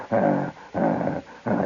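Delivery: very low growled "ah"; repeated low, throaty lion grunts; imitated lion. Storyteller imitating the lion behind the tamer, low growls. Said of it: "They got a different sound".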